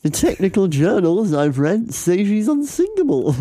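high, swooping English gentleman voice; hammed-up Englishman, pitch climbing with confidence; middle-aged Englishman. Stout Englishman reassures passengers she's unsinkable, high mock English voice. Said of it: "says a middle-aged Englishman".

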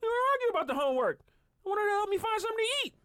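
put-on very high, indignant kid voice; bickering child. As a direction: mimic a child protesting in an argument. Acting out twins' homework argument.

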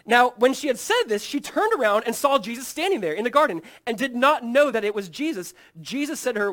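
loud, ringing reading of the Gospel; dramatic scripture reading. Acted. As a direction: read the passage like a preacher to the hall.

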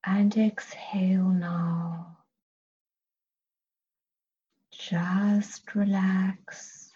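slow, soothing whisper. Acted. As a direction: let it out in a long hush. Whispered relaxation cue.

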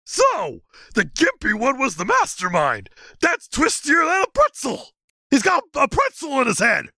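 high dopey creature voice; goofy high-pitched monster; Sasquatch voice. Acted. Sasquatch character voice.

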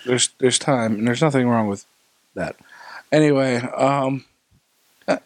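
usual speaking voice

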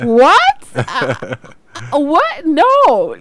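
high, loud retold shock; astonished disbelief. Host reliving her reaction to guest's video, astonished. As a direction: exclaim in hammed-up disbelief, well up in pitch.

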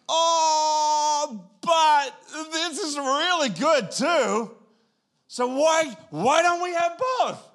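high, loud, drawn-out mock whine; torn pleading. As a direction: stretch out the temptation, then beg for both. Acting out the double-minded wanting both.

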